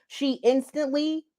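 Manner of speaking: usual speaking voice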